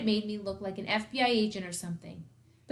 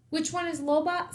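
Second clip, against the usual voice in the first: ordinary delivery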